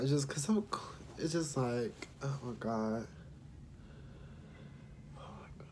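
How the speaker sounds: soft, broken phrases through tears; tearful, halting, trailing into whispers; crying. Real, not acted. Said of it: "I don't know why I'm crying".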